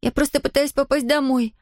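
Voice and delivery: put-on high, pleading girl's voice. A narrator's reading. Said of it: "взмолилась Зия".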